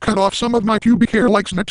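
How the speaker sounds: normal talking voice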